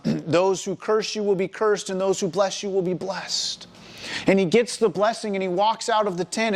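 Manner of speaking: normal talking voice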